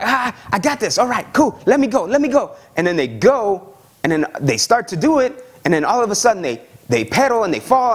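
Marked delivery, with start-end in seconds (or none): 0.0-2.5 put-on higher, cocky kid voice; eager kid. Child learning to ride a bike, higher and cocky. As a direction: brush off the coach like a cocky kid. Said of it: "So when they're like".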